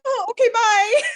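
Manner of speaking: very high, flustered and giggly; blurted in a starstruck panic; flustered squeak. Retold or imitated. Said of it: "and I was".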